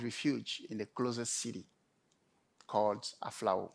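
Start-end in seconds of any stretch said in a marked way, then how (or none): none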